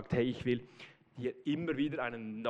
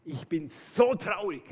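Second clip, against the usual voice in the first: higher, louder wounded complaint; hurt lament; 8 semitones higher, 4 dB louder than usual. Pretend. Jesus as he might have scolded Petrus, hurt and reproachful. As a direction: pour out hurt and disappointment. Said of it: "Er sagt nicht".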